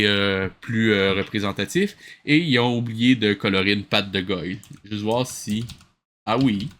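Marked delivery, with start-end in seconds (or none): none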